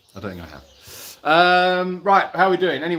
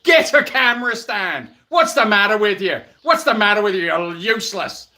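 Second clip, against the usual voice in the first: higher, louder mock-Irish accent; 3 semitones higher, 5 dB louder than usual. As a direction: put on an exaggerated, indignant Irish accent. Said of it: "that was my Irish accent".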